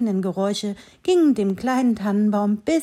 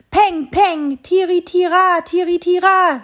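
higher, louder shots and hunting horn; hunting calls; 9 semitones higher, 7 dB louder than usual. A narrator's reading. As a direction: sing out the horn call over the shots. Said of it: "schallte es von einem Baum zum anderen".

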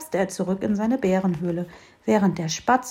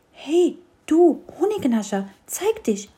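high, bright call into the dark; little tree calling out to the shadow; 8 semitones higher, 4 dB louder than usual. A narrator's reading. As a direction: summon the hidden honey thief. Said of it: "rief das Bäumchen".

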